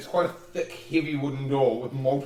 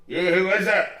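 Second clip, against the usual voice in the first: raised, louder gatekeeper voice; voice through the door; 5 semitones higher, 6 dB louder than usual. Acted. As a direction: call out suspiciously through a closed door. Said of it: "you hear the voice through the door".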